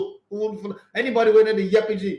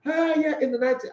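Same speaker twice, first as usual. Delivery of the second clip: a hint of the excited analyst, higher; imitating the analyst; 7 semitones higher than usual. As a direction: lightly mimic a friend starting his breakdown. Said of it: "he go talk like this".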